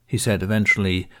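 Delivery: usual speaking voice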